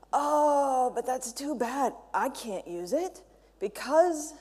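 slow, drawn-out imitation of a letdown; mock regret. Speaker voices developers excusing themselves from new CSS, mock regretful. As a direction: mimic a reluctant objector, stretching the words. Voicing people's excuse for not using it.

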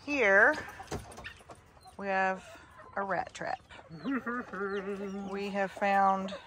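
ordinary talk

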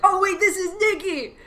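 surprised realisation. Retold or imitated. Fan recalling realising [name] was rapping, surprised. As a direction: act the sudden recognition. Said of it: "I was like".

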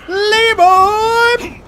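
drawn-out high battle cry; huge stretched-out gamer war cry; Leroy Jenkins. Pretend. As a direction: bellow it slowly as you charge in. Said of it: "I'm gonna Leroy Jenkins this".

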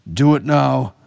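high, loud hostage-taker's ultimatum; shouted threat. A narrator's reading. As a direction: threaten loudly, demanding obedience.

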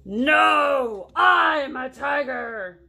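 loud, slow, stretched-out roar; indignant shout. Acted. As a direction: shout it to prove you are fierce. Tiger insists angrily.

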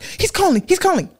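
high, urgent re-enacted alert; acted-out panicky heads-up. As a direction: alert mother in a high, pressing voice. Retold urgent alert to her mother.

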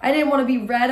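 plain reading voice

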